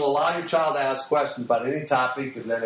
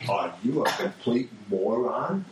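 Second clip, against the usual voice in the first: deadpan inner voice; 8 semitones lower, 3 dB quieter, slower than usual. Retold or imitated. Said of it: "in my brain I went".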